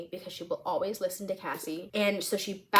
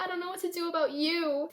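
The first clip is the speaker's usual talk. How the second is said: higher-pitched acted plea; pitched-up helpless confession; overwhelmed confession; 9 semitones higher, faster than usual. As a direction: voice a helpless, lovestruck girl. Acting out Cassie's overwhelmed confession.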